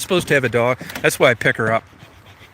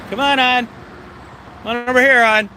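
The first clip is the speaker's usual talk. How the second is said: calling the dog; 10 semitones higher than usual. Real, not acted. Man calling his dog over to the view in high coaxing dog-talk. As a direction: call the dog over in a bright pet voice.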